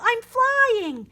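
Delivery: high, loud delight; soaring up and out; thrilled shout. A narrator's reading. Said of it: "yelled Angel".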